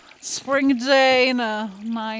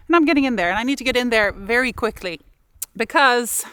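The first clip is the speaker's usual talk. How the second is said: rushed psych-up; 3 dB louder, much faster than usual. Real, not acted. Psyching up for icy water.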